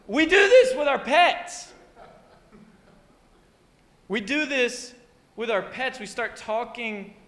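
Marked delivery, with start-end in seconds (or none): 0.1-1.7 put-on high, loud gushing baby talk; pet-talk voice. Shown as a demonstration. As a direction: keep the overexcited doggy voice going. Carries on the pet-talk demo.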